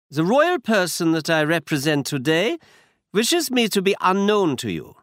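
put-on a masked aristocrat in a German accent. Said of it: "He had a strong German accent".

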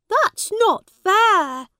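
high, loud, slow protest of outrage; angry outburst. A narrator's reading. Young prince to the judge's victims, angry and loud. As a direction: spit out the unfairness, slowly. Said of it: "He was very angry".

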